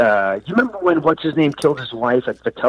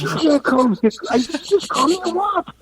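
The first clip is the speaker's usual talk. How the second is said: high, rising mock-offended protest; indignant protest. Friend protesting the comeback, high mock outrage. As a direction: play the friend's outrage, half joking. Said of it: "He goes".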